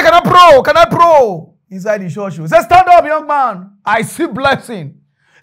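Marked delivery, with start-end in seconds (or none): 0.0-1.5 loud, high pastor's call; preacher's shout. Acted. Pastor asking the church if he can pray, loud preacher's shout. 2.6-4.9 loud, raised prophetic declaration; single someone out from the pulpit; preacher calling out. Acted. Pastor tells young man to stand, loud preacher's call.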